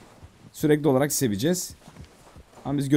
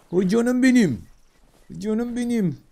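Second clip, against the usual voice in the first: put-on high, doting baby-talk; cooing pet talk; 8 semitones higher, slower than usual. Player sweet-talking the horse, high cooing. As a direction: coo at a beloved animal.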